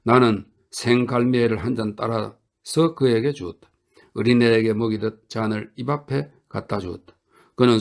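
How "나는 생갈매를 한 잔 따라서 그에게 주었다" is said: ordinary delivery, nothing marked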